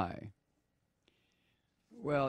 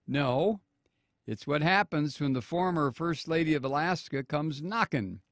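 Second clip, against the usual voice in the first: normal talking voice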